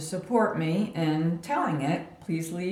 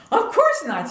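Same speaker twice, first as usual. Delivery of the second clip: indignant; 13 semitones higher, 6 dB louder than usual. Retold or imitated. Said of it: "She was indignant".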